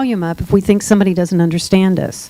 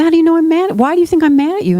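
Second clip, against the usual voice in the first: startled, high and quick; surprised; 8 semitones higher, much faster than usual. Retold or imitated. Speaker to deaf coworker, high and surprised. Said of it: "I said".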